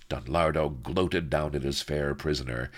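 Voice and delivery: plain reading voice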